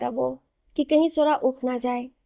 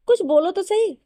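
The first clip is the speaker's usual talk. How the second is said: higher, lifted coaxing voice; coaxing plea; 7 semitones higher, 4 dB louder than usual. A narrator's reading. Hero coaxes silent Sora to say something, higher coaxing plea. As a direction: urge her to talk, pitch jumping up.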